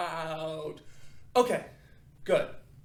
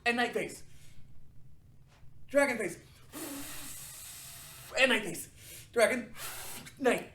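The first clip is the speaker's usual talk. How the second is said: calling out faces; 7 semitones higher than usual. Shown as a demonstration. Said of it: "so we're doing night face".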